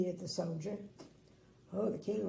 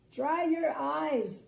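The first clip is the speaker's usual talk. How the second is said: slow, higher comforting; soothing command; 7 semitones higher, 6 dB louder, slower than usual. Acted. Little Miss Sunshine comforts the crying king, slow. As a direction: comfort him slowly. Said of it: "she said".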